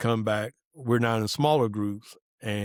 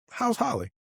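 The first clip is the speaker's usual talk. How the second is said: cheery question; 13 semitones higher than usual. Retold or imitated. Coworker asks about wife, high.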